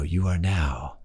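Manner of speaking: slow, low, lulling hypnotist voice; mock hypnotist. Son hypnotizing his family, slow soothing mock trance voice. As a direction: draw out "very relaxed" like a stage hypnotist.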